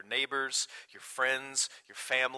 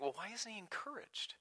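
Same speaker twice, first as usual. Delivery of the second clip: breathy hushed aside; whispered question; 8 semitones higher, 12 dB quieter than usual. Pretend. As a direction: whisper the listener's puzzled question. Imagined listener objection, whispered.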